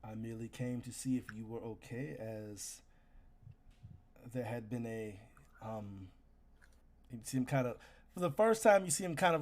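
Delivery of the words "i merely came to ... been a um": low, soft, solemn soldier taking the blame; quiet, grave apology from a noble fighter; remorseful warrior. Acted. Knight to the vampire player, low and grave.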